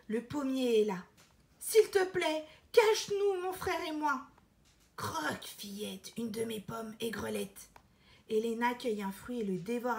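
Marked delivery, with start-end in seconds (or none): 1.6-4.3 put-on urgent little-girl plea; pleading girl. Elena begs again. 5.0-7.7 a sly tree murmuring; hushed tree voice; whispering apple tree. Acted.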